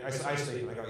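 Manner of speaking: usual speaking voice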